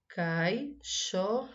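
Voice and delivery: "Caixote" broken up as cai-xo-te; syllable by syllable. Shown as a demonstration. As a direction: pause between the syllables of "Caixote". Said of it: "Separe em sílabas as palavras". ("Caixote" separado em sílabas)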